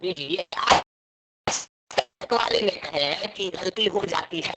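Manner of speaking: breaking up over the phone line; choppy distant phone audio; bad connection. Real, not acted. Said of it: "आवाज थोड़ी आपकी कांप के आ रही है".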